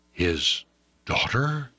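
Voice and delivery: plain reading voice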